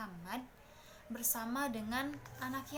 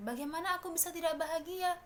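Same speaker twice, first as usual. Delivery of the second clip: put-on joyful child exclaiming; 7 semitones higher, 4 dB louder than usual. Orphan boy answers his friends joyfully.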